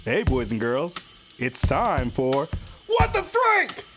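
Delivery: ordinary talk, nothing marked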